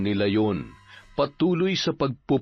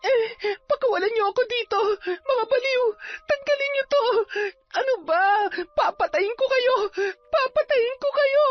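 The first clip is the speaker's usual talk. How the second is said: high, crying, furious screams; possessed; 9 semitones higher than usual. Acted. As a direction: thrash and scream like one possessed. Possessed girl tied down, raging.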